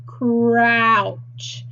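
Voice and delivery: "Crouch" stretched sound by sound in one glide; slow, blended "Crouch" for a phonics class; word on a curve. Shown as a demonstration. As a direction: draw out every sound of "Crouch", long r. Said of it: "Crouch is the word on the curve".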